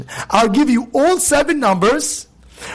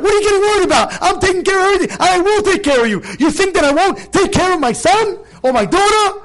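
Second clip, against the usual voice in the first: exasperated, high-pitched fatherly insistence; indignant reassurance, pitched well up; acted upset God; 9 semitones higher than usual. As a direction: act hurt that your care is doubted. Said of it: "Hashem's getting upset".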